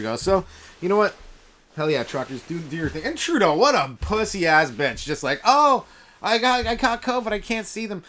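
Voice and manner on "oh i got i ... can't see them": mock whiny excuse. Mocking Trudeau's covid excuse.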